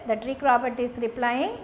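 normal talking voice

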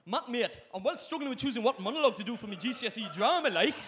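loud, quick put-on Geordie; half-committed Geordie kid brother; rough Geordie accent. Invented little brother asks for help, put-on Geordie accent. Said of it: "He might be Geordie".

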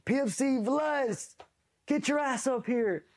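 high, drawn-out mock holler; imitated distant yelling. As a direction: summon him as if from several floors up, stretching each word. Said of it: "Yelling from the fucking third or fourth deck".

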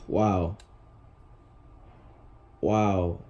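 low, soft, stunned and let down; deflated disbelief. Real, not acted. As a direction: mutter it like the money's gone for nothing. Crushed by bad pack pulls.

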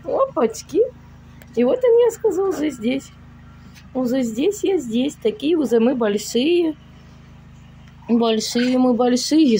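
put-on high sing-song baby talk; cooing baby talk. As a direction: coo at the kitten. Cooing to kittens. (сюсюканье с котятами)

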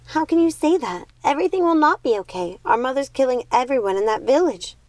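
put-on a girl shaking with anger; angry, shaking. A narrator's reading. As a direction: hurl it at her sister in outrage. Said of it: "Primrose was shaking with anger".

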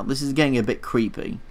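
normal talking voice

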